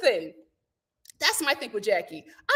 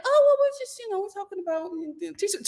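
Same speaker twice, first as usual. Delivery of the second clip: high, fading mock evasiveness; mock flustered stalling; 6 semitones higher than usual. Jackie caught gossiping by Teresa, mock flustered stalling. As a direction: start high and trail off evasively.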